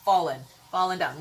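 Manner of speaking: ordinary talk, nothing marked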